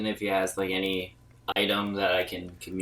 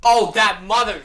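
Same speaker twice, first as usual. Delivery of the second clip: high, loud frustrated curse; frustrated outburst; 10 semitones higher, 8 dB louder than usual. Real, not acted. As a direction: blurt the curse out, cut off sharply. Mimic escapes into ship.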